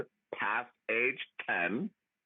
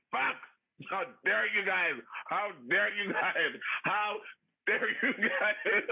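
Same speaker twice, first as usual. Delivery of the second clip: higher, drawn-out mock outrage breaking into a laugh; 8 semitones higher, slower than usual. Retelling how angry she got at the judges, hammed-up outrage. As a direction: ham up the indignant how dare you. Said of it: "I was like".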